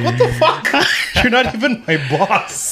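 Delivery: high, laughing mock indignation; laughing retelling. Retells an indignant comeback while laughing.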